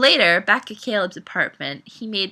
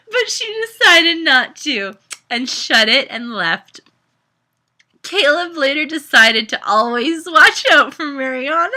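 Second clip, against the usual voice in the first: laughing; 6 semitones higher, 9 dB louder than usual. Real, not acted. Reading through laughter.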